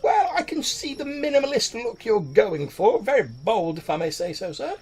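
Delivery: high, amused, backhanded compliment; sly tailor. Acted. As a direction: flatter him with a laugh in the voice. Tailor mocks minimalist outfit.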